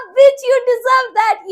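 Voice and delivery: loud, high, very slow taunt; taunting the injured classmate with a loud, drawn-out gloat; gloating shout. Retold or imitated. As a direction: shout it out, savouring every word. Mocking injured rival loudly.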